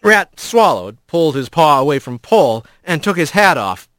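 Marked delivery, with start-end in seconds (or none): none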